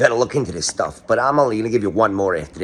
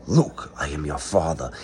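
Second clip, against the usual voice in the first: lower Vader voice; 6 semitones lower, 5 dB quieter than usual. Retold or imitated. Vader line in put-on voice.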